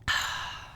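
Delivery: long breathy exhale after a drink; satisfied drinker's sigh. Acted. Patient after a swig of whiskey, long exhaled sigh.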